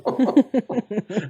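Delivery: high amused laugh. Real, not acted. Storyteller amused at being called parasitic looking, laughing. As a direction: laugh at your own story.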